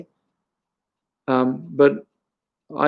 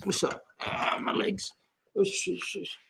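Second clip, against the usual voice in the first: much softer, breathy hushed aside; whispered shushing, far softer than the lesson; real whisper; 6 semitones higher, 14 dB quieter, slower than usual. Real, not acted. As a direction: drop to a whisper to hush someone nearby.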